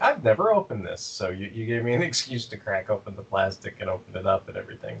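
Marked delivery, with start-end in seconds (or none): 0.0-1.0 surprised. Retold or imitated. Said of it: "I was like".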